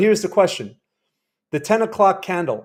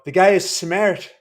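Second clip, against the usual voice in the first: slow mock Irish businessman; Irish accent; 2 semitones higher, 4 dB louder, slower than usual. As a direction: imitate an Irishman, stretching the words. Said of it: "I love his accent".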